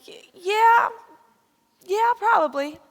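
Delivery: stammered, loud, high-pitched yeah; comic high-voiced owning up; squeaky reluctant admission. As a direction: squeak it out as a sheepish confession.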